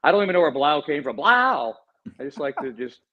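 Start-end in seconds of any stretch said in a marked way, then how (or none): 1.2-1.7 loud, high announcer exclamation; Will Ferrell's Harry Carey. Shown as a demonstration. Co-host demonstrating his borrowed catchphrase, announcer impression. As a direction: blast it out like a game-show host. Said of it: "Will Ferrell as Harry Carey that I'm ripping off".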